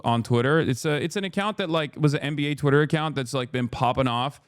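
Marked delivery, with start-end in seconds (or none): none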